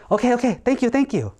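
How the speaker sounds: high, eager thanks; desperate bear. Acted. Desperate bear thanks the crow eagerly.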